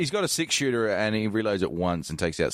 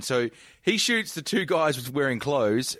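ordinary delivery

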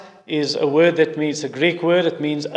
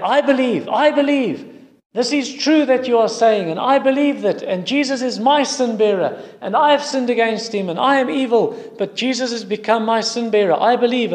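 higher-pitched, eager declaration of faith; joyful confession; 6 semitones higher than usual. Retold or imitated. Tribespeople rising one by one to declare their belief, joyful. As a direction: lift the voice in glad testimony.

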